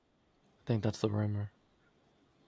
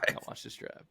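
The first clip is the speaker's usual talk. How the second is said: whispered, dead serious; whispered mock-serious; 15 dB quieter than usual. Friend echoing Bush, whispered mock seriousness. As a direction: hush it like a grave statement. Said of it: "talking all serious".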